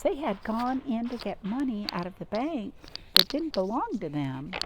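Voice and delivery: ordinary delivery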